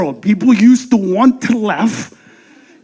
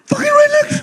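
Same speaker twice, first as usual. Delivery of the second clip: laughing punchline. Real, not acted.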